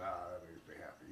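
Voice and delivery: low, muted gloating; spiteful. Acted. Someone pleased that a politician lost, spiteful. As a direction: gloat quietly, as if over a rival's loss. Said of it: "usually a spiteful s-, kind of thing".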